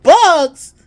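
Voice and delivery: high, very loud shocked shout; screaming. Retold or imitated. Mom repeating her child's whispered secret, screaming. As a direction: blurt it out far too loudly. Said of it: "You screaming, Mom".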